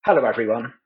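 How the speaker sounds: usual speaking voice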